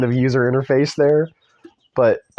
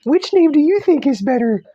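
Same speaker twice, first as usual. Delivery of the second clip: much higher and louder, a goofy put-on voice; a cartoonish squeal of fake delight; mocking silly voice; 4 dB louder than usual. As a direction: lampoon the characters dawdling. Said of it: "and they're like".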